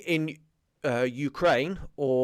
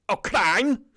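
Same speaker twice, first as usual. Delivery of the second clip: high, loud imitation of a narrator's strange foreign-tinged accent; Anthony Quayle's odd accent; 10 semitones higher, 7 dB louder than usual. Fan mimics a World at War narrator, high and loud in a weird accent. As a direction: ham up the old documentary narrator's weird accent. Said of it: "in some weird accent".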